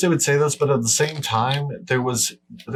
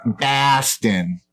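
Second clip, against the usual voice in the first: higher, hammed-up Boston accent; 4 semitones higher than usual. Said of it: "That's my Boston accent".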